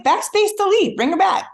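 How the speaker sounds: high, insistent mock order; exasperated. Pragmatic husband telling writer the fix, exasperated. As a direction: imitate a husband at the end of his patience.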